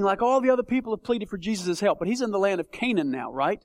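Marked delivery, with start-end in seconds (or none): none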